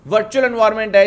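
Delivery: usual speaking voice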